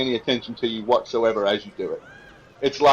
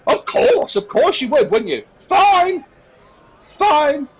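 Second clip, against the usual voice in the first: high-pitched sarcastic agreement; put-upon serving girl, pitched up; exasperated barmaid; 12 semitones higher, 3 dB louder, slower than usual. Acted. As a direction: give in to the guests in a huff. Voices the barmaid.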